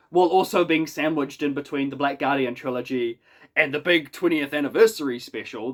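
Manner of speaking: ordinary talk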